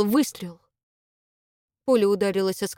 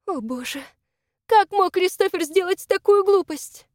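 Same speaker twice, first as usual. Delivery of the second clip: anguished dismay; 10 semitones higher than usual. A narrator's reading. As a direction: lament it in high-pitched despair. Dismayed woman pinned under fire.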